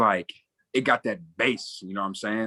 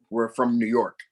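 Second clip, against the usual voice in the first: normal talking voice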